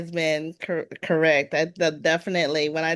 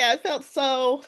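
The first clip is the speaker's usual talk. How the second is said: ordinary delivery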